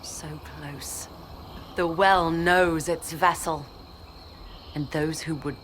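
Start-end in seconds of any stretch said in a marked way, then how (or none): 0.0-1.1 very soft, low murmur; hushed and low; hushed awe. Acted. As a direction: say it softly, almost to yourself. Villain nears the well.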